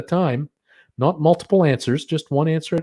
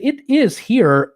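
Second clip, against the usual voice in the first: dramatic reveal; 10 semitones higher, 5 dB louder, slower than usual. Real, not acted. Dramatic reveal of Detroit's rank.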